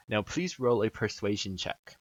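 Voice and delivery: ordinary delivery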